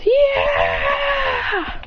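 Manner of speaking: high, long victory yell; yelled cheer. Acted. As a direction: hold a high celebrating yell. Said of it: "They all yell".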